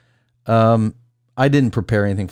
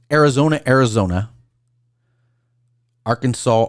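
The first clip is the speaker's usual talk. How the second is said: ordinary talk, nothing marked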